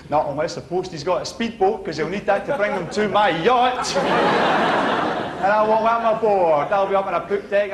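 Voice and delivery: usual speaking voice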